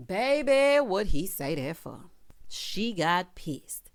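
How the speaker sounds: dramatic slowdown. Real, not acted. Builds suspense about her anger.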